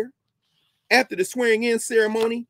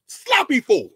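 Sloppy Ford sneered out, higher and louder; a higher, louder jeer on Sloppy Ford; mocking repeat; 6 semitones higher, 6 dB louder, slower than usual. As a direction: spit the name Sloppy Ford back with scorn.

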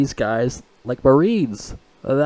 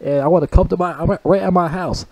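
talking tough; 6 semitones higher than usual. Retold or imitated. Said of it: "Denzel Washington was talking shit".

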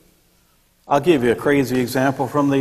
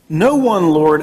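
No one, Lord said higher and louder, pitched up; high answer; 4 semitones higher, 5 dB louder, slower than usual. Retold or imitated. Preacher voicing the woman, No one, Lord pitched high.

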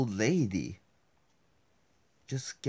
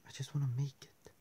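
fast, breathy whisper; quick whisper; 4 semitones higher, 7 dB quieter, faster than usual. Real, not acted. Player sneaking past Granny, quick whisper. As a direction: whisper it hurriedly.

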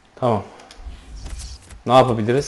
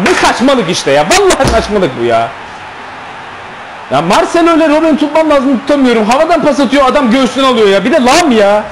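much higher-pitched exasperated rant; frustrated complaining; 7 semitones higher than usual. Real, not acted. Gamer complaining about his defence, pitched-up exasperation. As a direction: whine it out in disbelief.

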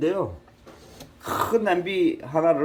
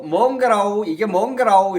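Jeolla dialect; 4 semitones higher, 4 dB louder than usual. Retold or imitated. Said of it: "그래서 전라도 사람이 또".

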